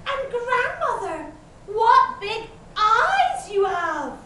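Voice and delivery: put-on high, astonished little-girl voice; amazed. A narrator's reading. Girl's growing amazement at wolf.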